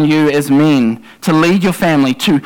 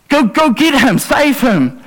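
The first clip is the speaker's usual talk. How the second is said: urgent yell; 7 semitones higher than usual. Pretend. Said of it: "yell out to the nearest person".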